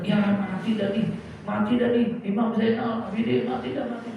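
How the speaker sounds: high, alarmed voice of frightened people; panicked crowd. Acted. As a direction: cry out in panic at the fire. Voicing panicked crowd in fire. (suara orang panik yang ketakutan, tinggi)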